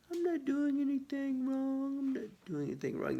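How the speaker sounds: high put-on innocent dog voice; a dog playing innocent, high then quick; mock innocent. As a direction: protest sweetly, playing the innocent.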